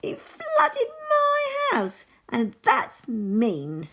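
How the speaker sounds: very high, slow, loud squeal; stretched-out squeaky outburst; tiny squeaky vole. A narrator's reading. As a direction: wail it as the tiniest angry animal. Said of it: "in a voice so squeaky".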